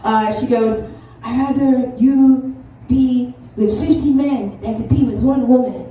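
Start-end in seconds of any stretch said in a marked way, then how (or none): 1.2-4.8 put-on slow, loud Haitian accent. Comic redoing mom's line in Haitian accent. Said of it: "let me do the accent". 4.8-5.9 put-on Haitian accent. Comic imitating Haitian mom's disapproval in Haitian accent. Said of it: "let me do the accent".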